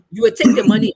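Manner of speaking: loud, forceful demand, the rebel general turning angry; angry order. Retold or imitated. Rebel general angrily ordering the reporter to take money. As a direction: bark the order so she has no choice. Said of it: "Then he became angry".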